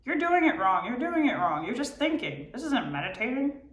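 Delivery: high, scolding inner voice; mocking inner critic. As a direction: scold yourself mid-meditation. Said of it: "mimicking and mocking what's going on in my head".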